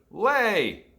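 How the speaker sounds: loud, high barked command; giant's shout. A narrator's reading. Giant shouting at his hen.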